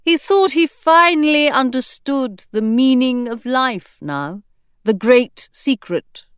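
ordinary delivery, nothing marked

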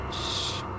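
hushing shh. Real, not acted. Hiding from the monster.

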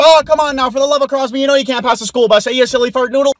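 high, loud folksy scolding; mock outraged yell. Canadian scolding a driver who passed a school bus, loud and high. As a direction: scold the driver loudly, polite but furious.

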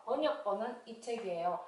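ordinary delivery

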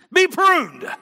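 high laughing mock decree; mock grand command.

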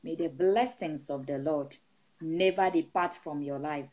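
steady, rhythmic prayer over the flock; prayerful blessing. Acted. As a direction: pray over the congregation with rising fervour. Pastor praying over the congregation.

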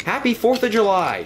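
cheerful holiday cheer, pitched high; happy shout. Acted. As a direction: shout it up at the fireworks. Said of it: "shouted Oliver happily".

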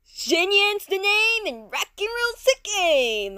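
loud slow rocker voice; Xinyan impression. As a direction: belt it like a rock star. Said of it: "I love Xinyan".

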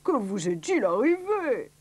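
slow, theatrical concern; mildly hammed-up astonishment; exclaiming doctor. A narrator's reading. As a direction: stretch out the shocked question. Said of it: "s'écria le docteur".

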